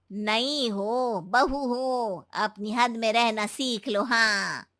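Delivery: low, slow, quiet stern warning. Acted. Mother-in-law warning her new daughter-in-law, low, slow and stern. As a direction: lay down the law slowly and coldly.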